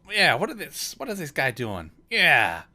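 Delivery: loud, high pirate jeer; second heckling pirate. Acted. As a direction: chime in loudly from the pirate crowd. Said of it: "another one go".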